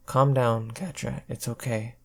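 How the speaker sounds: soft, quiet reassurance; calm her gently and softly; soothing. Acted. Adora soothing Katra.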